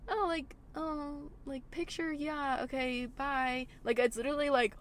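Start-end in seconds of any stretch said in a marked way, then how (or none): 0.1-3.7 slow, breathy-soft mock of a shy fan; timid starstruck fan. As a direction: tiptoe through it like a nervous fan. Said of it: "it's where it's like".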